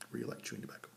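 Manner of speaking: soft whispered echo. Shown as a demonstration. Confessing his love of chew to viewers in a whisper. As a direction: breathe it again like a guilty secret. Repeats the line, second time whispered.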